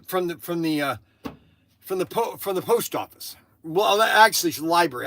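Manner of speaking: ordinary talk, nothing marked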